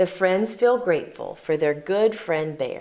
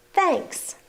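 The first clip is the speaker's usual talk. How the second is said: high bear thanks; 10 semitones higher than usual. Acted.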